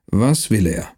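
ordinary talk

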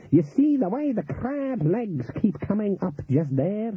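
high, slow voodoo-woman character voice. Acted. Voodoo woman showing the cook signs in the pot, intent. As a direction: draw it out high, pointing out each sign.

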